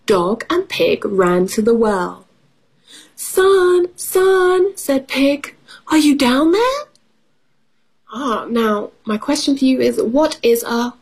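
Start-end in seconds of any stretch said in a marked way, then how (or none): 3.2-4.8 high, drawn-out storybook call. A narrator's reading. Storyteller as Pig calling into the well, high drawn-out call. 5.9-6.8 high questioning call, rising at the end. A narrator's reading. Storyteller as Pig searching the well, high rising call.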